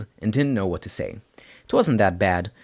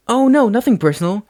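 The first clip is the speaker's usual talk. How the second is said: put-on higher, louder flustered boy; nervous boy voice; 13 semitones higher, 7 dB louder than usual. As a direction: blurt it out, flustered. Izuku denies nervously.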